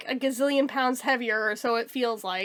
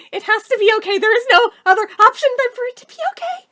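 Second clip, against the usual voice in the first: desperate insistence; 9 semitones higher, 8 dB louder, faster than usual. Real, not acted. Convincing herself it'll be okay.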